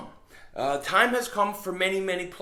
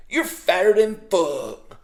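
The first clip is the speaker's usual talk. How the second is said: slow southern drawl, drawn out and louder; southern accent; 2 semitones higher, 7 dB louder, slower than usual. Retold or imitated. Voice in the speaker's head at the scale, loud slow southern accent. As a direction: draw it out like a southern voice in your head. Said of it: "it has a southern accent".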